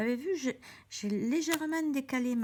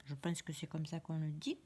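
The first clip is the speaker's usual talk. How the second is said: unsure whisper, much softer, to herself; whispered aside; 6 semitones lower, 7 dB quieter than usual. Real, not acted. Crafter doubting her English pronunciation to viewers, in a whisper.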